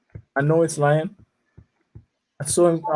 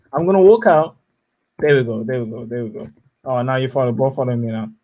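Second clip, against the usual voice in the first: usual speaking voice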